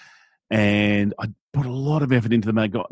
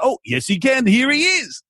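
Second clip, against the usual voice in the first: squeaky high put-on voice, much higher; cartoonish jump into a falsetto character; playful phone prank voice; silly high voice; 14 semitones higher than usual. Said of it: "I'd answer the phone and just go".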